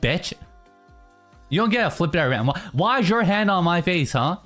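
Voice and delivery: usual speaking voice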